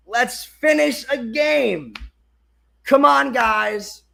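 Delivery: high, drawn-out rallying cry; urging yell. Real, not acted. Fan urging his team to finish, urging yell.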